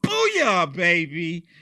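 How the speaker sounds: loud exultant booyah; gleeful cheer. Real, not acted. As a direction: shout it out like a fan at a game. Cheering Trump's order.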